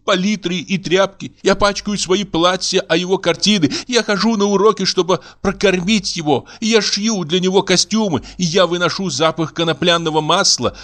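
high-pitched woman listing grievances; long-suffering indignant wife. Acted.

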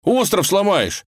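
high, alarmed exclamation; frightened. Acted. Ivan warns the girl about breaking the island, frightened. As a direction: cry out a panicked warning. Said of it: "испугался Иван".

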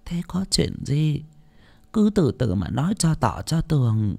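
put-on feeble old woman. A narrator's reading. Old lady calming the frightened boy, speaking feebly. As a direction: coax it out with little strength. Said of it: "bà già ngồi xuống cái ghế gỗ mà thều thào".

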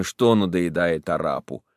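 plain reading voice

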